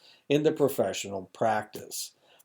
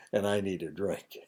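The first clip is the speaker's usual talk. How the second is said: whispered under the breath; whispered aside; 2 semitones lower, 4 dB quieter than usual. Real, not acted. Dry-throated aside to the class.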